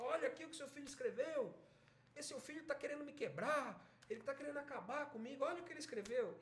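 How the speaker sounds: high, rapid indignant complaint; angry father. Acted. Irritated father showing his wife the telegram, angry. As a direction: rant in outrage, acted out. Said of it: "ele fica bravo e tal".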